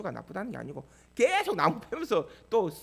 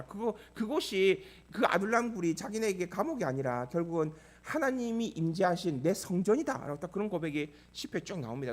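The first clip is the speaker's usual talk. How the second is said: ordinary delivery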